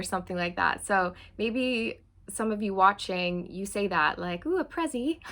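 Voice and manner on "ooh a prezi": high-pitched hammed-up English accent; excited mock-English squeal, badly faked; lame English accent. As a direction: put on a bad, playful English accent. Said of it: "That was my lame accent".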